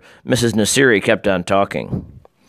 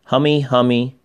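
lightly voiced toddler calling, slightly high; little child's voice; 4 semitones higher than usual. A narrator's reading. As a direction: call it out with a hint of a small child. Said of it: "a little voice called out".

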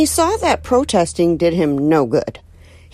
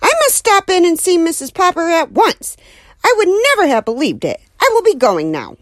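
flustered neighbor; 11 semitones higher, 3 dB louder than usual. Acted. Flustered neighbor hurries away.

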